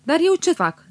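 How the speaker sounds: a hurt, indignant little outburst; Stănică protesting, offended at being left out. A narrator's reading. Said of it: "zise cu un aer jignit Stănică".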